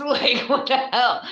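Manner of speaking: high, loud, laughing outburst; real incredulous laugh through the words; laughing disbelief. Real, not acted. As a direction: crack up while saying it, pitch shooting up. Laughing at own mock pronunciation.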